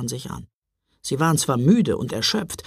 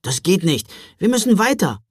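urgent pleading; 6 semitones higher, 3 dB louder than usual. Acted. Boy urges sister in snow.

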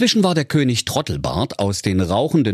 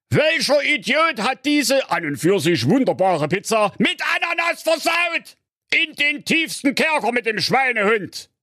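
high, furious royal shout; raging king. Acted. King raging about pineapple on his pizza, high furious shout. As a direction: rage at whoever ruined the pizza.

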